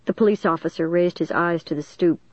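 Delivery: ordinary delivery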